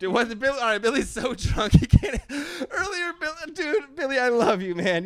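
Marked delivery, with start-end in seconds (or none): 1.7-5.0 excited high squeal. Real, not acted. Overjoyed cry to drunk friend.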